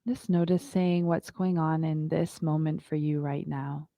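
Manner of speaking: calm, unhurried yoga teacher voice; guided meditation. Acted. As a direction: guide the class into stillness. Leads a yoga breathing meditation.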